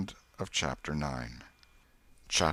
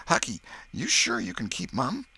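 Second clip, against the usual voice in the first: put-on high, worried boyish question; anxious boy; 8 semitones higher, 5 dB louder than usual. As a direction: ask anxiously. Tom asks Huck.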